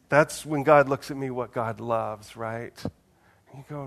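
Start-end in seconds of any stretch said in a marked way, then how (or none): none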